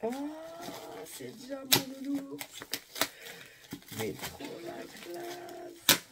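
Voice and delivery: high, slow, cooing voice; high, slow, doting coo; cute gushing. Real, not acted. Gushing over the teddy bear.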